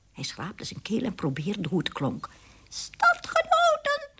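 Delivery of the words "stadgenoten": very high, drawn-out mouse squeak; eerie squeak. A narrator's reading. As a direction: squeak out a slow speech opening as a mouse. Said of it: "was een eng gepiep".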